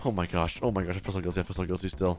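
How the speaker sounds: low, hushed guilty muttering. Real, not acted. Player admitting guilt to the viewers, muttered low. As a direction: mutter it softly and low, to yourself.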